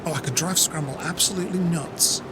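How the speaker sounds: ordinary talk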